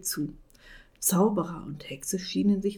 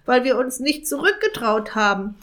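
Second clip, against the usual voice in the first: loud, higher raven moaning; grumbling raven; 5 semitones higher, 5 dB louder than usual. Acted. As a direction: complain like an aching old raven. Raven complains bitterly.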